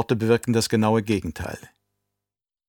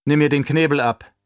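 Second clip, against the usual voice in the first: terse, raised commanding voice; curt, imperious order; sharp command; 4 semitones higher, 5 dB louder than usual. A narrator's reading. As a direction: order it sharply, louder and higher. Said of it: "knapp, scharf und befehlend".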